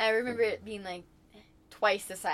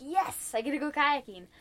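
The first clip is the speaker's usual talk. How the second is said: higher-pitched acted delight; retold excitement; 6 semitones higher than usual. Retelling the moment she unwrapped a kayak, excited. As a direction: replay a kid's thrill, pitch lifted.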